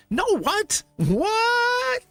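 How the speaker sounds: high squeaky "What?" in disbelief; shocked squeal. Real, not acted. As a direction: squeal it out, stunned by the card. Shocked by the card.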